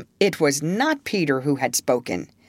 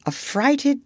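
usual speaking voice

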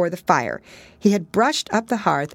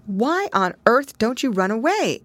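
higher, exclaiming; horrified; 7 semitones higher than usual. Acted. Pigling horrified at Pigwig's fate. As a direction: cry out in alarm. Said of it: "exclaimed the horrified Pigling".

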